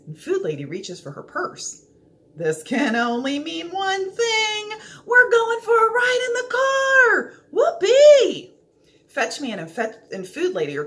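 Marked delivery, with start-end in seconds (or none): 2.4-8.5 rising from eager to a loud, high whoop; a dog whipping itself into a joyful frenzy; building excitement. A narrator's reading. Dog realizing it's going for a car ride, ecstatic.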